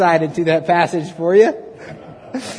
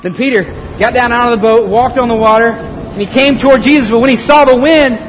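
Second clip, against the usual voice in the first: loud, raised storytelling over a storm; dramatic scripture narration; 6 semitones higher, 8 dB louder than usual. A narrator's reading. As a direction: project the Bible story high and loud.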